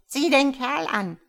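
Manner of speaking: high, loud clucking hen voice; hens' voice. A narrator's reading. Hens mocking the stork, in a high loud voice. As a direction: sneer like a flock of hens. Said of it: "sagten alle Hühner".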